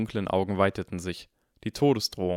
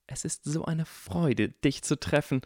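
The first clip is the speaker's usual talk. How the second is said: higher, slow, rapturous fanboy; gushing delight; 6 semitones higher than usual. A narrator's reading. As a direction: savour the honour of meeting. Draco gushing at his idol.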